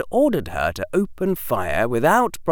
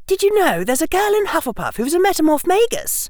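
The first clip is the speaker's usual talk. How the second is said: put-on much higher, quick girl's voice; chatty schoolgirl; 4 dB louder, faster than usual. Hermione tells Harry about a classmate, high and chatty. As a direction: share news fast in a high-pitched voice.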